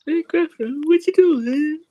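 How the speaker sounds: very high squeaky cartoon voice; toy pet voice. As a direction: squeak it like a tiny creature.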